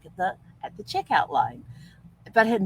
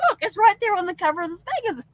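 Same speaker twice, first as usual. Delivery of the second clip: much higher, fast, eager; mock excited pointing; 14 semitones higher, faster than usual. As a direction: sell the headline breathlessly. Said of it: "I'd point and I'd go".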